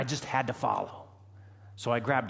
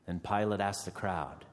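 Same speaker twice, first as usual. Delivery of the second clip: ordinary delivery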